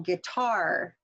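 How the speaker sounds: "guitar" slowly, stress on the second syllable; light first syllable, drawn-out second on "guitar"; syllable stress demo. Shown as a demonstration. Teacher saying words for students to sort, second-syllable stress on "guitar". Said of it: "when I say these words".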